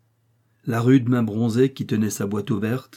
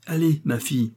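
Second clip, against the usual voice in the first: usual speaking voice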